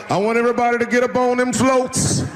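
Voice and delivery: lightly voiced Martin Luther King; preacher-style MLK. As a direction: rouse the crowd from the pulpit. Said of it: "If Martin Luther King was like".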